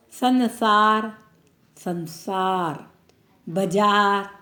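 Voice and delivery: संसार said very slowly, twice; slow dictation. Shown as a demonstration. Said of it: "slow dictation". (संसार को बहुत धीरे लिखवाते हुए बोलना)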